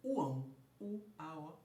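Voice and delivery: slow, loud classroom breakdown of uão into U-A-O; spelling out the sounds. Shown as a demonstration. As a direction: pull uão apart into U, A, O, one vowel at a time. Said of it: "já que eu tenho".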